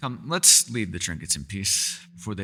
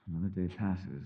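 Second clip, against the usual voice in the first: weary sigh; 8 dB quieter than usual. Acted. Weary evening monologue.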